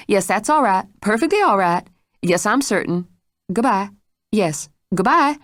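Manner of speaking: lightly voiced hurried maid; maid on phone. A narrator's reading. Maid hurries the caller off the phone, lightly voiced. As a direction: hurry the caller off the line.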